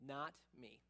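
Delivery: low, smug self-assurance; arrogant chutzpah. Acted. Peter setting himself apart from the other disciples, quietly arrogant. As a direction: drop low for a cocksure denial. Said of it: "chutzpah and arrogance".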